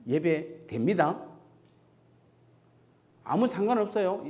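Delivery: ordinary talk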